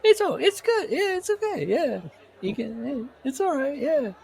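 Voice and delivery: very high, squeaky mock critic voice; a cartoonishly high voice giving a half-hearted review. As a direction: squeak out a silly fake book review. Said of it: "Here's my review of Catcher in the Rye".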